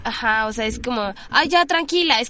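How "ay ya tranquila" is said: a brushing-off "ya, tranquila", a bit higher; condescending calm-down; dismissive tone. Retold or imitated. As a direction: wave her off as if she's overreacting. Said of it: "en el tono en el que te lo dicen".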